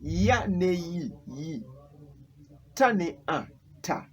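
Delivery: teacher sounding out "yi" and "ta" after their letters; syllable spelling drill. Shown as a demonstration. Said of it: "I can give you another example".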